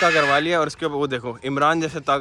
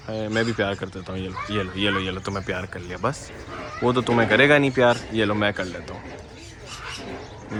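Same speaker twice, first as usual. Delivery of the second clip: cooing to a bird; 6 semitones lower than usual. Real, not acted. Cooing softly to a cockatoo.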